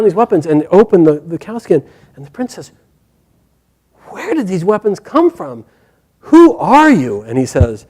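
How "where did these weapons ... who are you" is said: stunned, demanding answers; astonished. Acted. Prince startled by weapons, slow astonished demand.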